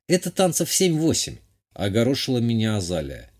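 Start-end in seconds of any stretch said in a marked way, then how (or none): 0.1-1.4 lightly voiced countess, higher pitch; a touch of a lady's high voice; matter-of-fact lady, slightly put on; countess Azalia.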